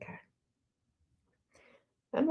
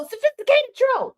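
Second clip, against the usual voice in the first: mock pointing call; 14 semitones higher than usual. Pointing out a troll.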